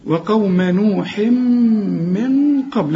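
very slow وقوم نوح من قبل with two long nasal hums; two ghunnas. Shown as a demonstration. Teacher recites to show the rule, two ghunnas. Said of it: "عندك اتنين غنة هنا".

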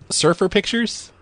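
surprised high question; can't believe it, rising; incredulous. Real, not acted. Incredulous repeat.

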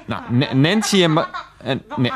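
ordinary delivery

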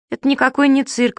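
high-pitched, offended protest; objecting. A narrator's reading. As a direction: object like an insulted performer. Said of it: "возразил Субастик". (возражая, высоким голосом)

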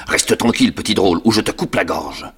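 loud menacing threat; threatening convict. Acted. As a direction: growl out a death threat.

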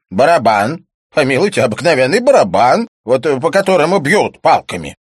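loud, high blustering character voice; high, emphatic scoffing; cantankerous cynic, scoffing. A narrator's reading. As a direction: proclaim it loudly and scornfully.

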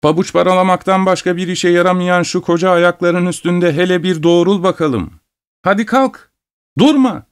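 high-pitched, exasperated telling-off; high, insistent motherly nagging; nagging scolding. A narrator's reading. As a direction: order a lazy son up, pitch climbing. Mother scolds her idle son.

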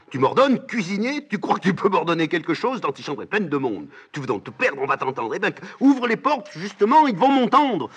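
loud, high, furious challenge; defiant shouting. Acted. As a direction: shout so everyone outside hears. Antigone défie l'ordre de se taire. (défi hurlé, voix aiguë)

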